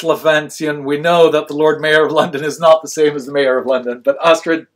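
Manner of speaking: ordinary talk, nothing marked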